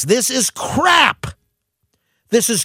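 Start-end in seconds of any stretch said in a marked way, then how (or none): 0.0-1.3 angry shout. Real, not acted. Host rants to listeners about the health care bill, shouting angrily. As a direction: spit it out in real anger, slowly. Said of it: "I am so pissed off".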